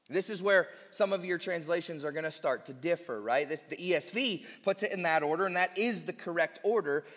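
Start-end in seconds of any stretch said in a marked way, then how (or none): none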